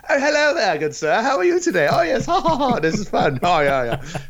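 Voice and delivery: high, hammed-up posh gentleman voice; posh toff. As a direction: prance about as a fancy gent, greeting everyone. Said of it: "that's not how they talked".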